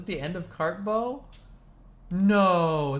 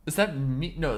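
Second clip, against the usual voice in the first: ordinary talk, nothing marked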